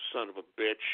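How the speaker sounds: bitter under-breath swear; muttered curse. Acted. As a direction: mutter it to yourself.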